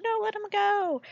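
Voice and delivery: high-pitched mock wail without full volume; mock scream. Mom restrained while son is treated, mock screaming. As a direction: imitate a desperate scream, held back. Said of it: "she's, like, screaming".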